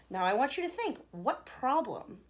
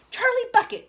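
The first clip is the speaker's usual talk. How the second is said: high, loud call of a name; cried out; 13 dB louder than usual. A narrator's reading. Wonka calling Charlie forward to the screen, loud and eager. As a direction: summon him eagerly. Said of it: "cried Mister Wonka".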